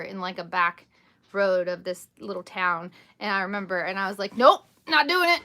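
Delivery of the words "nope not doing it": high, loud retold refusal; punchy re-enacted no-way; emphatic refusal. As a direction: refuse outright, acting out the moment. Retold flat refusal while driving.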